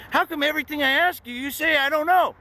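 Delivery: fast, high exasperated demand; mock the cop losing patience; irritated cop. Cop fed up with his answers, high and fast. Cop getting mad at him.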